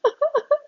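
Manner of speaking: loud, high laugh; high burst of laughter. Real, not acted. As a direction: crack up laughing. Laughs at the joke.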